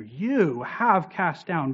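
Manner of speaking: slow, weighty "You"; stress on You. Shown as a demonstration. Teacher stressing who acted, with a slow "You". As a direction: press down on "You" slowly. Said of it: "God is the subject".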